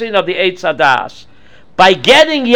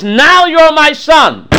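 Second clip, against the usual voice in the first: slow, weighty words, a touch higher; slow earnest mother; 6 semitones higher, 4 dB louder, slower than usual. Acted. Rivka tells Yaakov he is her son now, slow and firm. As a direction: press the claim gently but firmly. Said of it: "she says".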